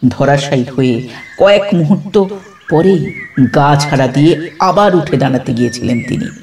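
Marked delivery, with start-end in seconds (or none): none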